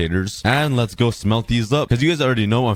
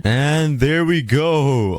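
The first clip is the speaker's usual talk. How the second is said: slow, stretched and higher "And there we go"; drawn out; 5 semitones higher, much slower than usual. Real, not acted. Finishing the build, drawling "And there we go" slowly. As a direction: savour each word of "And there we go" slowly.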